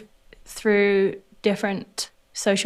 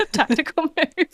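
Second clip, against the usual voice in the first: laughing, higher, amused echo; laughing repeat; 8 semitones higher than usual. Real, not acted. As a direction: laugh the phrase back at yourself. Repeats her own line laughing.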